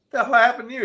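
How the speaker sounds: high-pitched, incredulous acted question; startled question. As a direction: react to a friend's battered state. Spider-Man sees battered Superman in joke.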